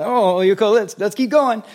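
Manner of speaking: high, loud upbeat coaxing; cheerful encouragement. Retold or imitated. Father cheering his stumbling kid to keep running, high and loud. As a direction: cheer the kid back onto their feet. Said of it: "You know".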